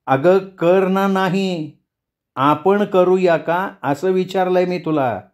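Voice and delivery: slow down and press each word home; emphatic word by word. A narrator's reading. Rekha correcting Mrunal's reply, stressing every word. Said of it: "प्रत्येक शब्दावर जोर देत म्हणाली".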